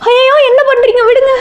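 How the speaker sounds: alarmed protest. Acted. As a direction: cry out in alarm to make him stop. Father tries removing her thali. (பதறிய உயர்ந்த குரல்)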